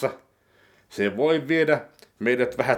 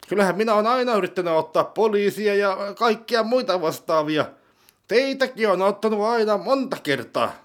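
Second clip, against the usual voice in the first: pitched-up dim sidekick, defensive; high character voice; 9 semitones higher than usual. Acted. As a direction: play the naive accomplice, voice pushed higher. (kohotettu hahmoääni)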